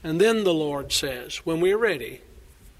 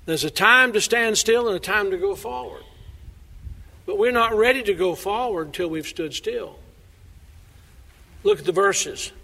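ordinary talk

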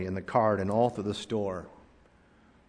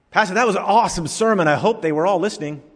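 high, fast mock praise; gushing churchgoer; 7 semitones higher, 4 dB louder, faster than usual. Churchgoer to the pastor after service, lightly voiced gushing. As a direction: gush like a smug congregant.

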